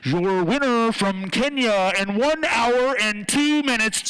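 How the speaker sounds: mock stadium PA voice; PA announcer. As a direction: boom it over the loudspeaker. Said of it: "They come over the P A".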